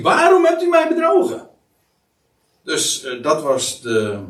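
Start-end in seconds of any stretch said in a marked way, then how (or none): 0.0-1.5 much higher, louder and faster shriek; a medium shrieking at the disguised king; shocked scream. Acted. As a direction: scream it out in sudden alarm. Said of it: "ze schreeuwde met luide stem".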